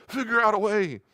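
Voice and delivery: mock impatient parent; scolding parent. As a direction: brush the kid off impatiently. Parent yelling at stuck kids.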